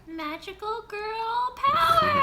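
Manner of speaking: very high, drawn-out battle cry rising to the end; magical girl mage. Pretend. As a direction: squeal it like a magical girl transforming. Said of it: "That's my mage".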